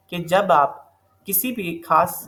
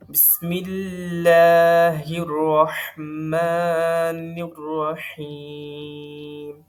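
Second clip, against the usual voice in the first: very slow, drawn-out melodic recitation; slow chanted tajweed recitation; Quranic recitation; much slower than usual. Acted. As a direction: recite as if opening a reading of the Quran. Recites ta'awwudh and basmala.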